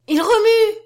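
high, loud shout of joy; a child's piercing, elated exclamation; overjoyed cry. A narrator's reading. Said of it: "cria-t-elle, folle qu'elle était de joie".

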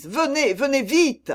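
high urgent shout. A narrator's reading. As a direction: yell for him to hurry. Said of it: "criait Aramis".

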